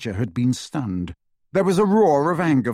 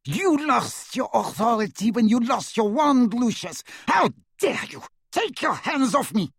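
much higher, contemptuous witch; shrill witch voice; 11 semitones higher than usual. A narrator's reading. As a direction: sneer it out, high and cutting. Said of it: "she sneered".

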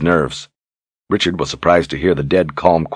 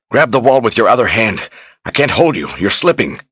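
higher, desperate urging; urgent strain; 5 semitones higher than usual. Acted. Richard to Kahlan dangling over the drop, urgent. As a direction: call out urgently while losing your grip. Straining to hold her from falling.

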